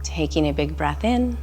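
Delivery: calm meditation voice with long pauses; guided meditation. Real, not acted. Instructor guiding listeners through a body scan, calm meditation voice. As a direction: lead a body scan gently, leaving room to breathe.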